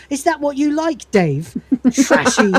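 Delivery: high, hammed-up scornful jibe; mock-scandalized contempt; jealous taunt. Jealous machine taunts Dave.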